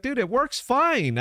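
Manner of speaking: high, slow, amused dev brushing it off; mocking dev team. Dev team laughing off the boss's complaint, high and slow. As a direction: pitch it way up like a smug coder. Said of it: "laughing back at me".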